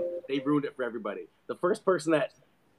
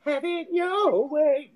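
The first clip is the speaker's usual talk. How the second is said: high, slow sing-song slogan then flat no; mock slogan; 12 semitones higher, much slower than usual. Rude cashier mocking the Burger King slogan to a customer. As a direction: sweetly tease then refuse.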